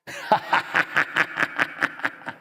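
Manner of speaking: airy unvoiced laugh; short breathy chuckle. Real, not acted. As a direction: laugh at the punchline. Laughs after story.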